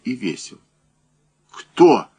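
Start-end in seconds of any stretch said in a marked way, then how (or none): 1.5-2.1 high, loud demanding call; sharp cry. A narrator's reading. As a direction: cry it out as a startled challenge. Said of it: "крикнул Данилов". (выкрикнуть резко, требовательно)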